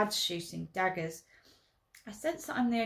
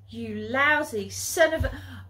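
louder, slow furious outburst; angry insult; 5 semitones higher, 9 dB louder than usual. Acted. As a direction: spit the insult at him. Furious sister insults brother.

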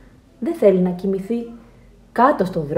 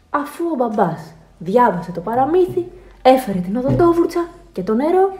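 plain reading voice

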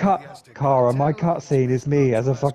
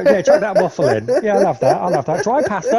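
normal talking voice

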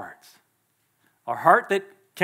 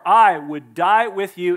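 sworn vow; 8 dB louder, slower than usual. Retold or imitated. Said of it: "He swore".

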